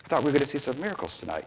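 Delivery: soft, rapid grumble of disappointment; disappointed crowd. Retold or imitated. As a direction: lightly voice a let-down onlooker, hurried and hushed. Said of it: "was completely disappointed".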